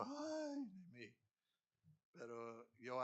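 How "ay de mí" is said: mock woe. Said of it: "Y yo".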